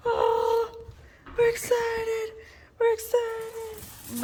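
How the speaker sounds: high, slow, sing-song talk to pets; excited dog baby talk. Acted. Owner gushing to her dogs about harnesses, high baby talk. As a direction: coo at the dogs like they're babies.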